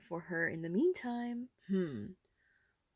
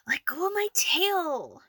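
strained whisper; pained whisper; 9 semitones higher, 4 dB louder than usual. Acted. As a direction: hiss it through the pain. Tail pulled in fight.

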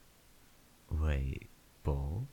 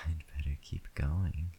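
whispering. Acted. Intimate whispered roleplay.

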